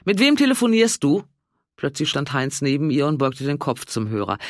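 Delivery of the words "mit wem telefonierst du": suspicious Walter. A narrator's reading. Walter misstrauisch am Telefon.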